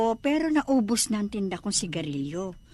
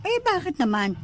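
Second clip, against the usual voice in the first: surprised; 10 semitones higher than usual. Acted. Anita shocked no cigarettes sold.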